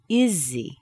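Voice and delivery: IS HE as one word, like izzy, falling; falling, connected. Shown as a demonstration. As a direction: link IS onto HE and drop. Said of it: "words are connected together".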